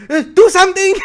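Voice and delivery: high, loud, helpless squeal of a flustered teacher; panicked teacher. Retold or imitated. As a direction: plead for help like a teacher who has lost control. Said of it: "Is that exactly how he sounded?".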